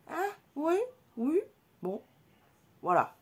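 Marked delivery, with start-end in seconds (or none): 0.1-2.0 light high-pitched mother voice; high mocking voice. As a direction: squeak it out dismissively. Mother's reply, retold.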